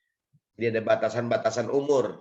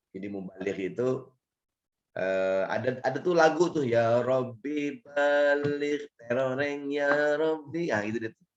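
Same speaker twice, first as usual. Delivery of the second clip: hoarse, rough voice; 2 semitones higher than usual. Real, not acted. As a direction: speak with a husky, hoarse throat. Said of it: "suara saya serak-serak becek".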